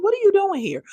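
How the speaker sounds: high-pitched, affronted challenge; incredulous confrontation. Acted. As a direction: confront an unexpected visitor in a high voice. Imagined confronting an unwanted visitor.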